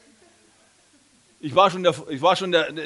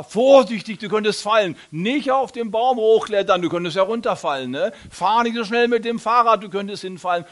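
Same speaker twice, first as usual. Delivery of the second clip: higher, louder anxious mother voice; worried mother; 6 semitones higher, 5 dB louder than usual. Retold or imitated. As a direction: fuss like an overprotective mother. Worried mother's warnings imitated. (ängstliche Mutter, höher und lauter)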